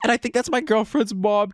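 ordinary delivery

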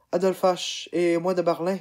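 delighted cry of recognition; exclaiming. Acted. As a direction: burst out in happy surprise. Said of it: "s'exclama-t-elle".

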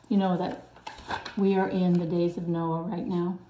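ordinary talk, nothing marked

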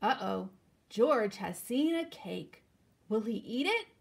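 ordinary delivery, nothing marked

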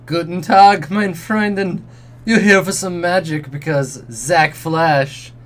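loud, high-energy showman with drawn-out words; cheerful elf salesman. Acted. Elf greets a customer for magic, cheerful showman voice. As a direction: pitch magic with slow, loud flourish. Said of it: "his voice surprisingly deep".

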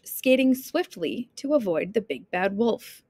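plain reading voice

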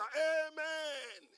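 high, shouted, long-held response; roaring amen. Retold or imitated. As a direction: imitate a crowd's fervent amen. Imitates excited congregation's amen.

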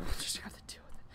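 hushed aside under the breath; whisper. Real, not acted. Hot in his room, whispered aside to viewers. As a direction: whisper it to yourself.

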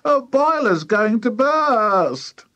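high, loud, drawn-out wail; high-pitched slow alarm. A narrator's reading. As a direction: wail it like a panicking wife. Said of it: "Missus Cranky wailed".

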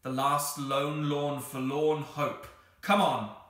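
loud, slow, high theatrical declaration; dramatic cry. A narrator's reading. Anthea rallies the others to one last hope, dramatic cry. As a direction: proclaim it grandly, stretching the words. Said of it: "cried Anthea dramatically".

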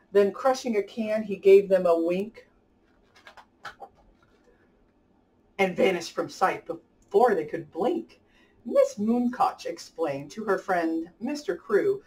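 lively rhyming storybook read-aloud; reading to children. A narrator's reading. Host reading a rhyming picture book to kids, lively storybook voice. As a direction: bounce the rhymes along for young listeners.

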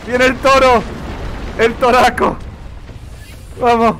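loud, high shouts as the bull charges; excited shouting. Real, not acted. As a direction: call out the incoming bull in a real frenzy. (gritos de emoción)

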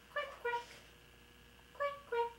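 high quacking duck. Pretend. Mom quacking high like a duck for her child. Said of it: "The duck says".

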